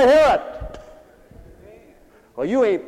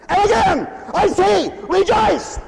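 high, loud command; shouted command; 11 semitones higher, 5 dB louder than usual. Shown as a demonstration. Pastor repeating the command to rejoice, shouted high. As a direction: bark it out as an order. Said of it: "How do you get people to do that".

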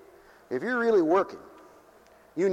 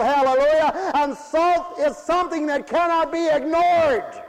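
higher, louder, slow preaching cadence; full-force preaching; 10 semitones higher, 5 dB louder, slower than usual. Acted. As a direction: preach it out with hallelujahs.

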